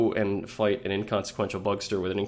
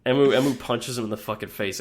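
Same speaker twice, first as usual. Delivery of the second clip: normal talking voice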